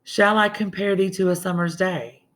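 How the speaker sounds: measured poetic reading; poem recital. Shown as a demonstration. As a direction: savour the question as verse. Teacher reads the sonnet aloud.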